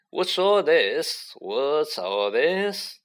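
slow, drawn-out teacherly inquiry; pompous teacher. A narrator's reading. Lockhart striding towards the crowd, pompously inquiring. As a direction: stretch the repeated question out grandly.

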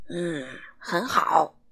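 deep giant voice. A narrator's reading. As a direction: rumble it slowly like a giant. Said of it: "巨人说".

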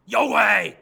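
a loud monster-ish yell; shouting. Acted. Bajie shouting at the monster under the water, loud yell. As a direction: roar the challenge. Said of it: "高声喊嚷".

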